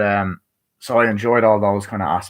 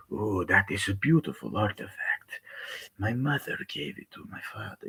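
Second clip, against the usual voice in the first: a hint of a soft menacing villain, much quieter; lightly voiced, hushed and sly; intimidating villain; 10 dB quieter than usual. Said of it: "intimidating villains".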